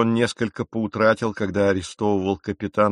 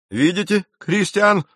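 much higher gloating question; triumphant; 10 semitones higher, 4 dB louder than usual. A narrator's reading. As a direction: crow over your rival. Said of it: "торжествующе осведомился капитан". (торжествующе)

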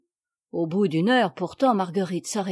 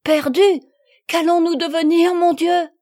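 higher and louder frightened cry; a lost girl crying out to God in fear; terrified; 7 semitones higher, 7 dB louder than usual. Acted. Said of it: "répéta Marguerite avec terreur".

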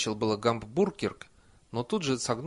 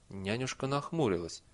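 plain reading voice

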